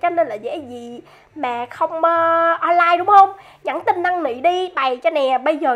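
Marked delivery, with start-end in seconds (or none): none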